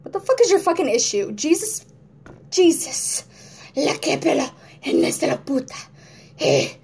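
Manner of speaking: loud, high angry rant; pissed. Retold or imitated. Acting out her angry mood, loud swearing. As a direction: rage at nothing, cursing. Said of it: "I'm just like ... pissed for no reason".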